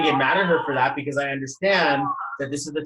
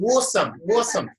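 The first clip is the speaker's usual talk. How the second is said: high-pitched mock Aussie exclamation; imitated Australian accent; 6 semitones higher than usual. Host who loves their accent, playful high Australian accent. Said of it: "I'm addicted to their accent".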